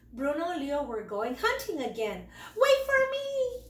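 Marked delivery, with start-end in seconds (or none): 2.6-3.6 much higher, louder call. A narrator's reading. Little cub calling after his brothers, high and loud. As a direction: shout after them, high. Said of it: "called Squeak".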